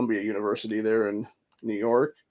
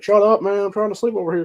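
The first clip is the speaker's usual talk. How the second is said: mock yell, higher and louder; annoyed neighbour; 8 semitones higher, 3 dB louder than usual. Grumpy neighbour yelling at the streamer to shut up, mock shout. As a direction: snap at the noise like a grumpy neighbour. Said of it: "people bitching and moaning and be like".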